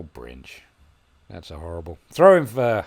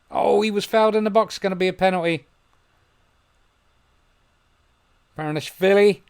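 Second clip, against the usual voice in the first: high, loud, building to a yell; real excitement; 9 semitones higher, 8 dB louder than usual. Real, not acted. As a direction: lose it over a penalty. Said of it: "Sorry, I get a bit excited sometimes".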